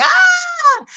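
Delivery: a sudden, very high excited squeal. Retold or imitated. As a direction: shriek with joy at the good news. Retold joy at her passing.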